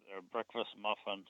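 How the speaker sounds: usual speaking voice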